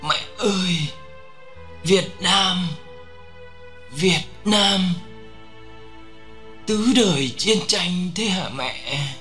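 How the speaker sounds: high, slow strained last words; drawn-out, halting deathbed plea; dying, feeble gasping. A narrator's reading. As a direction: gather your last strength to call to mother. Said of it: "cố gắng dồn chút sức tàn còn lại để thều thào".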